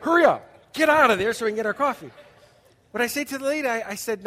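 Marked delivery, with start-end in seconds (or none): 0.0-2.1 mock impatient crowd. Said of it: "They're probably like".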